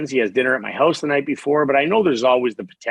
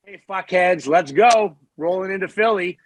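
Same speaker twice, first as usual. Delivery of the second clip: higher-pitched cocky mock heckle; playful taunt; 6 semitones higher than usual. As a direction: call out a pretend taunt to rivals. Imagined taunt rolling into town.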